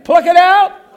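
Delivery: loud preacher's call to repeat. Shown as a demonstration. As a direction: belt it for the crowd to echo. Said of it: "Say it again".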